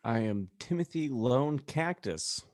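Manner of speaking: ordinary delivery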